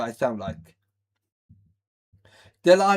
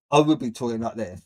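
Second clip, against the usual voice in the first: mock serious; 5 semitones lower, much faster than usual. Shown as a demonstration. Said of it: "if I was being serious".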